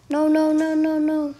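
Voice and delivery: usual speaking voice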